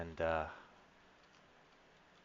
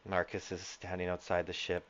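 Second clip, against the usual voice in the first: ordinary delivery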